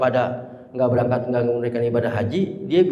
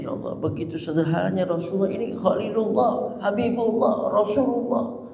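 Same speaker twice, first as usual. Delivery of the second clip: tearful Abu Bakar; 5 semitones higher than usual. Retold or imitated. Abu Bakar seeing the Prophet sleep on a mat, tearfully. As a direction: lament the Prophet's simplicity as if weeping. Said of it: "Abu Bakar sampai nangis gitu".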